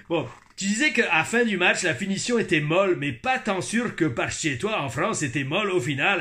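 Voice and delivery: put-on Québécois reading, easing off; resume the message in Quebec French; Quebec accent. (accent québécois)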